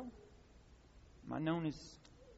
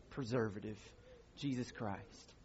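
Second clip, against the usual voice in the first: dropped, hushed tag; 6 semitones lower, 10 dB quieter than usual. Acted. Sermon punchline, dropped voice.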